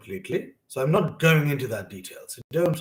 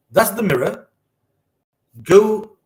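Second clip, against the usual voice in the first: mother's instruction; 5 semitones higher, 9 dB louder than usual. Retold or imitated. Retold mother sending child to mirror.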